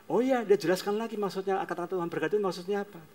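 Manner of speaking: ordinary delivery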